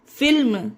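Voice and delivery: "फिल्म" with the L heard before M; "फिल्म", L kept audible; sounded L. Shown as a demonstration. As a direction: pronounce both L and M in "फिल्म". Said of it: "फिर भी इसे फिल्म पढ़ेंगे".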